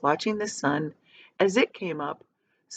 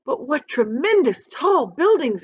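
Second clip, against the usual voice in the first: higher, louder amazed exclaim; 7 semitones higher, 5 dB louder than usual. A narrator's reading. Ladybug amazed at the tall buildings below. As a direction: gasp it out in wonder. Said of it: "exclaimed the ladybug".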